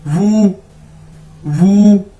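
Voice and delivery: loud wu drill; Chinese wu repeated. Shown as a demonstration. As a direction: repeat वू clearly for learners. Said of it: "इसको ऐसे pronounce करेंगे".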